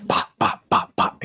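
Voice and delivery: unvoiced pop pop gun sounds; imitated gunshots. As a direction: mouth the shots in a quick burst.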